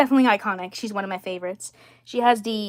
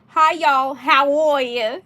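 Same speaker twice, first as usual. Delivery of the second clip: put-on cheerful Alabama farm girl, louder and higher; Bridget; 4 semitones higher, 6 dB louder, slower than usual. Shown as a demonstration. Collector voicing her farm-girl doll, very cheerful. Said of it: "very cheerful".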